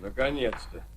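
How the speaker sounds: very soft, low aside; relieved murmur. Acted. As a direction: breathe out relief under your breath. Relief that danger is over. (облегчённо, вполголоса)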